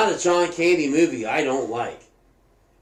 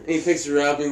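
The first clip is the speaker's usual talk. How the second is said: ordinary talk, nothing marked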